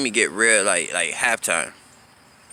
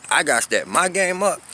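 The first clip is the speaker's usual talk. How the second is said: mock indignant; 9 semitones higher than usual. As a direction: pitch jumps high in outraged disbelief on "I gotta step my game up". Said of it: "you telling me I gotta step my game up".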